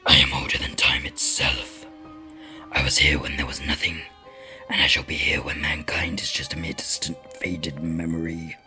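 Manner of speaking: deep raspy demon whisper. Acted. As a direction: hiss it like a lurking demon.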